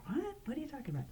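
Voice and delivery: high, whispered disbelief; puzzled whisper. Pretend. Friend reacting to the bet in a high whisper. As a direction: whisper it like you can't believe it.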